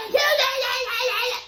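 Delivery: high-pitched imitation of a repeated yell; mock yelling. Imitating the clip of British yelling, high mock yell. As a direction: squeal the yeahs like the clip. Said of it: "with her yelling".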